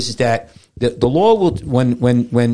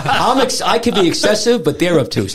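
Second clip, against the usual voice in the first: laughing; 6 semitones higher than usual. Real, not acted. Laughing self-defence.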